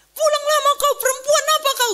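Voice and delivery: high-pitched, indignant scolding; acted-out parental telling-off, voice pitched right up; imitated angry father. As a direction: scold a daughter as an outraged father would.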